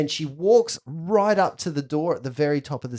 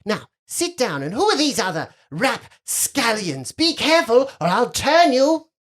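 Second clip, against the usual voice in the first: put-on bossy girl, rising louder and higher; imperious little girl; 10 semitones higher, 5 dB louder than usual. Girl with a wand to her unruly guests, imperious. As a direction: threaten them like a haughty young lady.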